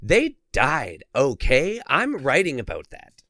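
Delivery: hammed-up moral scolding with punched words; imitating the girlfriend. Girlfriend accusing the artist of profiting from deaths, mock-indignant. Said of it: "She's like".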